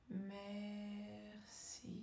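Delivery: MERCI very slow and soft, both syllables held level; stretched syllables. Shown as a demonstration. Coach leads word-smoothing exercise after a breath, drawn-out MERCI. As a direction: draw out MER, then a long soft CI. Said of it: "allonger le mot, allonger la syllabe".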